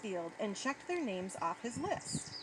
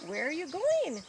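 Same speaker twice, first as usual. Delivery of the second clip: raised, higher questioning call; calling after someone; 6 semitones higher, 4 dB louder than usual. A narrator's reading. Harley calls after Boyd running off, calling out. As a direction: call it after a departing friend. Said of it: "Harley called after him".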